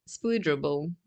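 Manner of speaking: ordinary delivery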